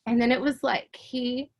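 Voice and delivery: ordinary talk, nothing marked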